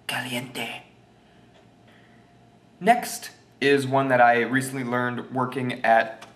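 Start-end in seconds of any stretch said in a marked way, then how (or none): 0.1-0.8 soft, mock Spanish-accented word; put-on Spanish accent. Shown as a demonstration. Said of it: "sort of an accent".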